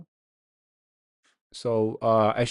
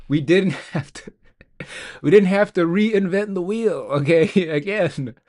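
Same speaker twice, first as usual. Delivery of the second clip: louder, higher, breaking into laughter; laughing, emphatic and raised; amused outburst; 4 semitones higher, 5 dB louder than usual. Real, not acted. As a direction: declare it loudly through real laughs. Amused that the code came back.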